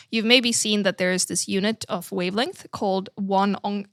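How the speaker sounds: normal talking voice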